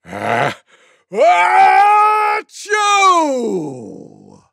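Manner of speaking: pause, then an enormous pretend sneeze; loudest mock sneeze. A narrator's reading. Tiger giving the loudest sneeze imaginable, huge mock sneeze. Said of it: "gave the loudest sneeze".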